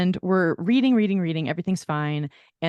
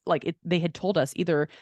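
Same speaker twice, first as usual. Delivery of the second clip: ordinary talk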